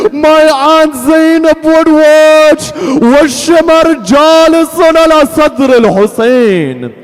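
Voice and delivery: full-force grieving preacher, sustained high pitch; wailing lament sermon. Acted. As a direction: wail out the tragedy to the congregation. Tearful lament at the tragedy.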